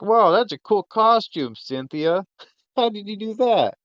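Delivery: high-pitched gushing wonder, acted out; amazed admiration. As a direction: play an impressed onlooker, voice way up. Voicing someone admiring a costume.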